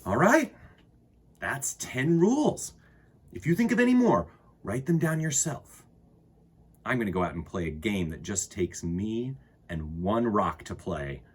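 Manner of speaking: put-on unhurried read-aloud for kids; storybook reading. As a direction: read it like storytime to little ones. Reads a children's book aloud.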